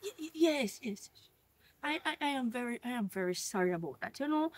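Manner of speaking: soft, halting, nervous apology; flustered wife backing down quietly; timid stammer. Acted. Wife apologizing to the angry mother, stammering softly.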